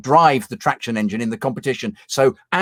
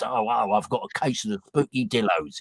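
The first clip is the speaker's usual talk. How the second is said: Tortoise's catchphrase; 3 semitones lower, 7 dB quieter than usual. Retold or imitated. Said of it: "he had a great line".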